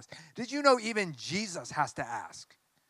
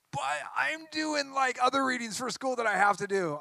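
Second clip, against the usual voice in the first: higher, mock whiny student; whiny student excuse; 8 semitones higher than usual. Teacher mocking students who claim they lack time, higher and whiny. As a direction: play the excuse-making student, pitched up and put-upon.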